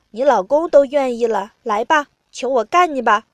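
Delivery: loud, goading challenge; loud taunt. A narrator's reading. Narrator voices the speaker taunting a partner, loud taunt.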